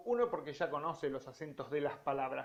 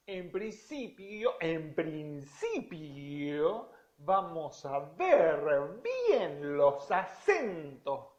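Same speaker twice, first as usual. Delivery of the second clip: very slow, each syllable marked; deliberate slow articulation demo; over-articulated; much slower than usual. Shown as a demonstration. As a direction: spell out "En principio, en principio" slowly and clearly. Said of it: "para tener una excelente articulación".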